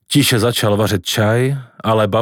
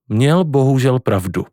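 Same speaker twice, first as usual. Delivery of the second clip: plain reading voice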